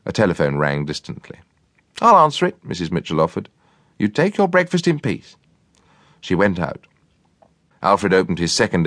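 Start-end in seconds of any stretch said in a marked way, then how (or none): 2.0-5.4 high, obliging cook's voice. A narrator's reading. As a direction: offer kindly as the cook. Cook's voice in story.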